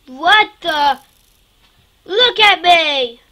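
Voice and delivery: loud startled cry at the screen; startled shout. Real, not acted. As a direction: yell in sudden shock. Avatar suddenly changed.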